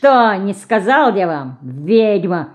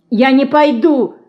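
high, loud, scared refusal; frightened; 7 semitones higher, 6 dB louder than usual. A narrator's reading. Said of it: "с испугом сказал Иоська". (испуганно и громко)

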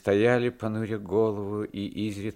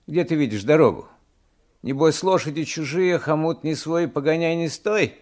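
higher, louder grumbling protest; displeased retort; 6 semitones higher, 5 dB louder than usual. A narrator's reading. Coachman disputes the stranger's directions, displeased. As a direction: object with mild indignation, pitched up. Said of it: "спросил ямщик с неудовольствием".

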